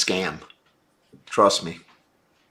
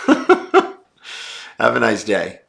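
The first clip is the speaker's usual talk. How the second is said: laughing; much slower than usual. Real, not acted. Laughing off a viewer.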